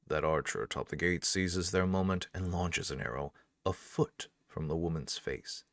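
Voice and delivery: ordinary delivery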